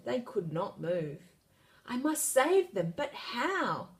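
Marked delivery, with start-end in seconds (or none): 1.8-3.9 worried princess. A narrator's reading. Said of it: "wondered Priscilla".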